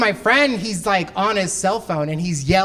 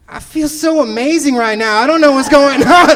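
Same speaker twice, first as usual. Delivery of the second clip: blissed out; 5 semitones higher, 7 dB louder than usual. Retold or imitated. Friend high on drugs.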